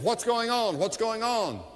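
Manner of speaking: high, insistent questioning; acted urgent plea for news; urgent asking. Blind man urgently asks the passing crowd.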